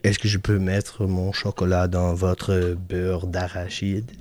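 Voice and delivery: low, soft French delivery; French line. Shown as a demonstration. Performing the line in French on request, low and soft. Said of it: "Say that in French".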